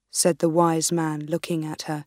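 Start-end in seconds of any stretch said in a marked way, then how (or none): none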